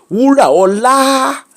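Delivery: high, slow, drawn-out name call; long singsong summons, imitating an insistent mother. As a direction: mimic mom stretching out her daughter's name. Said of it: "She say".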